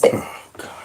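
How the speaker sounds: exasperated whisper. Real, not acted. Host exasperated at clip.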